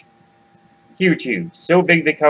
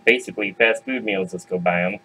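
plain reading voice

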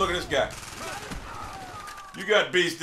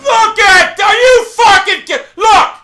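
high, loud yelling; outraged shout; 8 semitones higher, 9 dB louder, slower than usual. Real, not acted. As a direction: protest furiously at the game. Outraged at the game.

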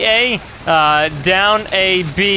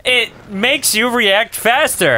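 slow, high, hammed-up answer; mock testimonial; 9 semitones higher, slower than usual. Friend answers the advert host, exaggerated testimonial. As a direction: deliver it like a coached customer.

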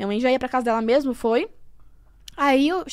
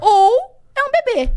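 slow, high, louder reveal of the news; doctor slowly announcing the baby, higher and louder; dramatic doctor reveal; 8 semitones higher, 5 dB louder, slower than usual. Retold or imitated. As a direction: drop the bombshell slowly like the doctor.

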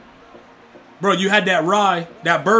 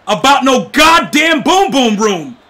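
louder, slow exasperated yelling; fed-up shout; 5 semitones higher, 7 dB louder, slower than usual. Real, not acted. Streamer yells at chat to drop the topic, louder and slow. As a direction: shout him down, done with it.

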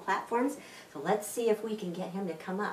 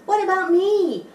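high, loud plea; eager pig; 12 semitones higher, 10 dB louder than usual. Acted. Pig begging the horse, high and loud. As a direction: call out not to be forgotten. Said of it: "says Pig".

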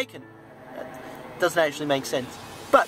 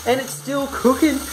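higher, lively cooking commentary; excited announcement; 7 semitones higher, 4 dB louder than usual. Pretend. Vlogger updates viewers on the cooking bacon, excited. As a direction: announce it with raised pitch.

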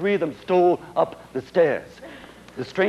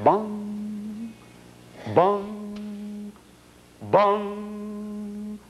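long drawn bell tolls, softer and hollow; imitated church bell; 4 semitones higher, 4 dB quieter, much slower than usual. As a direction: toll each bong slowly like a distant bell. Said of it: "booming of a church bell".

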